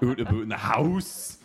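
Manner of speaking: hammed-up Canadian accent, swooping up on house; exaggerated mock Canadian accent. Mocking what a friend expects, exaggerated Canadian accent. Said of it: "that's not what it was supposed to sound like".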